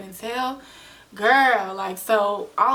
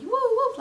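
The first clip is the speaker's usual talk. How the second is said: much higher whooping siren sound; siren chirp imitation; 3 dB louder than usual.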